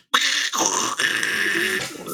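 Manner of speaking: harsh hissing noise-show static; imitated knob noise. Friend mimics fiddled knobs at a noise show, imitated static. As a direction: make a long burst of crackling static. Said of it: "that are sounding like".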